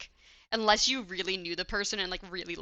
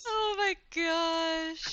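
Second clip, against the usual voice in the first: horrified; 9 semitones higher, much slower than usual. Real, not acted. Horrified by face mask idea.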